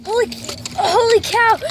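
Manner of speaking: loud startled holy cow; shocked exclamation. Real, not acted. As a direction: blurt it out in shock. Everything collapsing.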